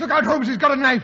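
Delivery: high, loud warning cry; alarmed shout. Acted. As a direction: yell the warning at Holmes. Watson warns Holmes of knife.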